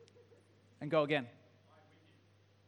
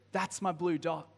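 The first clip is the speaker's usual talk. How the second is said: normal talking voice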